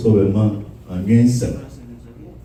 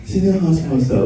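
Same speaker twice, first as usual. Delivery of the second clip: normal talking voice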